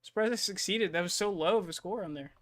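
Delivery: thrilled at a lucky roll; excited. Real, not acted. Player reacts to lucky roll.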